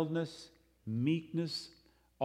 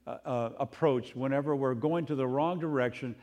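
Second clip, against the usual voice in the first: ordinary talk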